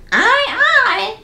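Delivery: high squawking parrot. Acted. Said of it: "said the parrot".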